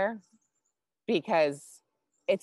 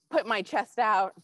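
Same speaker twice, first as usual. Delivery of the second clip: ordinary talk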